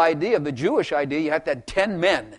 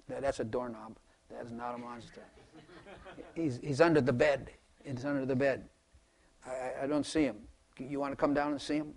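much softer, soothing; hushed parent; 3 semitones lower, 10 dB quieter than usual. Retold or imitated. Parent reassuring scared child about monster, hushed soothing. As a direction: gently talk the child out of it.

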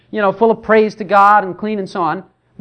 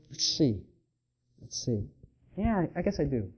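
much softer, unsure "let's see", stalling; hesitant husband; 6 semitones lower, 14 dB quieter than usual. Retold or imitated. Husband answers his wife's question softly, unsure. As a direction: search yourself before answering. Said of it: "Try to figure out some feeling".

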